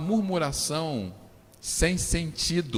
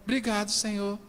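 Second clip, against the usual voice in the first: soft, hollow hymn-like thanks; mock pious thanks; 3 semitones higher, 4 dB quieter than usual. Preacher mocking a sufferer's fake calm praise, softly. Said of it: "você não vai ficar falando".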